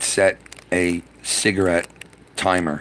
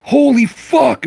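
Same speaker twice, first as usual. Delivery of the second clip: much higher, louder, drawn-out holy fuck; retold shocked yell, much higher and slow; stunned yell; 6 dB louder, much slower than usual. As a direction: react like you just saw the curtains go up. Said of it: "And I went".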